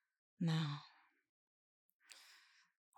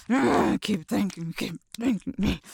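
aroused urging; 10 dB louder than usual. Acted.

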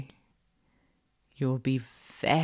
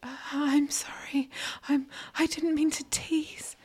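high, whispery submissive voice; nervous apology; 10 semitones higher, 4 dB quieter than usual. A narrator's reading.

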